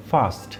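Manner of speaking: ফাস্ট with a long আ from the front of the mouth; front-mouth vowel. Shown as a demonstration. Teacher contrasting the front vowel. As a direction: keep the আ of ফাস্ট forward, not throaty. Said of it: "মুখের সামনের দিক থেকে".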